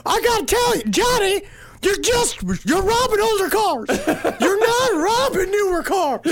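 high exasperated mock boss; Pee-wee voice. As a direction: whine it like a fed-up crook boss. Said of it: "P was like".

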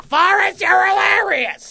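high, loud mock gushing shout; shrieking fans cheering a joke; crowd voice. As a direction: squeal it like a fawning fan. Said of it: "everyone's just like".

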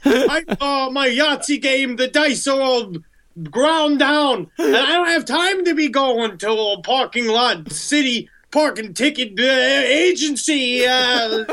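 high-pitched grumbling killer caricature; Son of Sam. Acted. Killer griping about his parking ticket, high cartoon voice. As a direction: complain in a silly, high put-on voice. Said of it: "I'm Son of Sam".

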